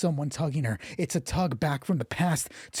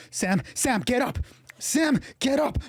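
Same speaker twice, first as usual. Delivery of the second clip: higher, desperate calling to a fallen friend; urgent plea; 8 semitones higher than usual. Acted. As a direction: plead urgently, pitch climbing. Brianna urgently tries to rouse Sam.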